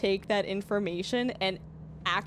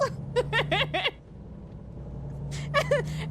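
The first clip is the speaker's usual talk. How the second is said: silent laugh; 11 semitones higher than usual. Real, not acted. Laughs at chat's correction.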